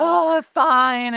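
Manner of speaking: high, loud mock-grudging oh fine; a hammed-up grudging surrender; reluctant giving in. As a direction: give in with a theatrical groan of defeat. Giving in to the baby.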